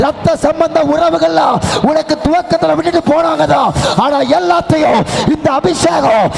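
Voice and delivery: rising from steady to a loud, high shouted climax; fervent preaching. Acted. Pastor rousing the Pentecostal church, building to fervent shouting. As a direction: build the sermon up to a ringing shout.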